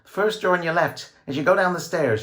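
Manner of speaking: high, loud hurried directions; frightened answer. A narrator's reading. Bound woman telling George the room, high and scared. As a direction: answer fast out of fear.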